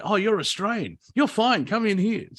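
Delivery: high, bright imitation of a relieved welcome; mock welcoming doorman. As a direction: play the friendly insider waving the Aussie in, pitched high. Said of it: "and it's like".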